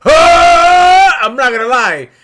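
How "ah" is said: short loud anime blast cry; mock blast yell. Friend mocking an anime attack with a loud cry. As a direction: yell it like a cartoon energy blast.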